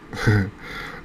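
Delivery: short rueful chuckle; real laugh. Real, not acted. Angler plagued by eel can do nothing, rueful chuckle. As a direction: chuckle briefly, resigned to it.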